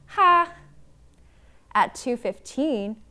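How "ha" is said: loud high acted "ha"; one bright burst of mock baby laughter; baby's single laugh. A narrator's reading. Baby laughing at her own private joke, loudly. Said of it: "Baby laughed at a jo".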